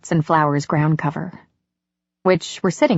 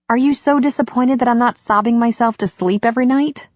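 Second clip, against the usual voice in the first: higher-pitched woman's voice, lightly voiced; a touch of playful challenge, pitched up; Katie, teasing; 6 semitones higher than usual. A narrator's reading. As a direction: lightly tease him as Katie.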